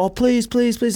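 high-pitched begging; pleading little kid. Retold or imitated. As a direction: beg like a small kid, high. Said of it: "And I was like".

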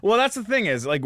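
usual speaking voice

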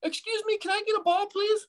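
put-on very high, sweet little kid voice; cute kid asking for a ball. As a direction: mimic a polite tiny kid asking nicely. Cute polite kid mimicked.

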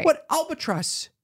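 high, slow disbelieving question; high, drawn-out what albatross; incredulous. Real, not acted. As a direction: ask it like you can't believe your ears. Incredulous reply to mother.

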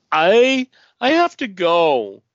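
high, loud stammer, then slowed; flustered exit. Acted. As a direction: blurt it out and bolt. Beck fleeing the room.

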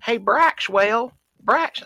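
put-on high, slow sing-song mom calling her kid; sugary mom calling Braxwell; mocking the hipster mom. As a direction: coo the name like a doting mother. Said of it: "the mama kept saying".

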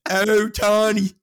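put-on loud, high Baltimore-accented yell; screaming Baltimore accent. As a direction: holler the name like a Baltimore fan. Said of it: "Screaming in a Baltimore accent".

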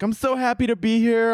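high-pitched, perky interview gush; mock gushing. Mimics gushing actress in interview.

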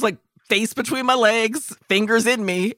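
ordinary delivery

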